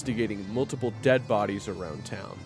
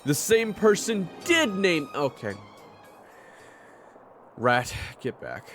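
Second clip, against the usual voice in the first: raised, forceful voice; 13 semitones lower than usual. Acted. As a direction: snap back, then order firmly. Snaps at rival, orders companion back.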